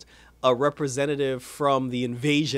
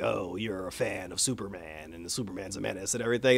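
gruff dad; 7 semitones lower, 8 dB quieter than usual. Retold or imitated. Dad disapproving of Superman article.

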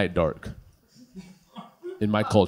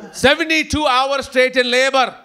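mom voice; 11 semitones higher, 5 dB louder than usual. Retold or imitated. Mom tells the clerk about her labor, high and loud. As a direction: play his mother, much higher and louder. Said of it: "coming up in her house shoes".

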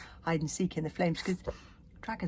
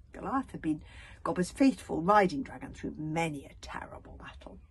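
ordinary delivery, nothing marked

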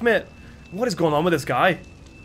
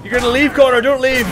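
higher, louder teasing plea; mock pleading; 6 semitones higher, 6 dB louder than usual. Real, not acted. Streamer teases a viewer who lost his bet, mock pleading. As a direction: beg him jokingly to stay.

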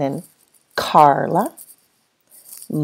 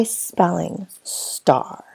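whisper; 7 dB quieter, much slower than usual. Acted. Teacher whispers to class.

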